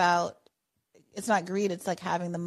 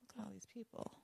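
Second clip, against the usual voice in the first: whispered aside to yourself; whispering; 19 dB quieter than usual. Real, not acted. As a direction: breathe it out under your breath. Host aside while scanning queue.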